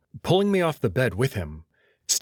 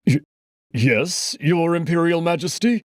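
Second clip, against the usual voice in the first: stammered, rising higher on the title; timid reply; 7 semitones higher, 4 dB louder than usual. Acted. Timid old doctor answering the emperor. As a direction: answer nervously, afraid of the emperor. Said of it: "He moves timidly".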